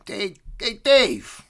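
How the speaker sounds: high, loud call to Dave; playful high-pitched hail. Pretend. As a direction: holler to get his attention. Calls out to imagined Dave.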